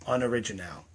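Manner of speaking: soft repeat. Real, not acted. Naming the trend sickness to viewers, dropped soft. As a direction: let it drop quietly.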